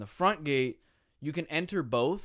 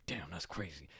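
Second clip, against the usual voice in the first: low, very soft retold reaction; hushed, lower mock disbelief; stunned undertone; 8 semitones lower, 14 dB quieter than usual. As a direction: say it under your breath to yourself. Alone, looking at the wreck.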